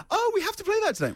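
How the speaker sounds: high-pitched, eager imitation; mock excitement. Imitating the co-host demanding a song, high mock excitement. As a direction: pipe up like an over-keen co-host.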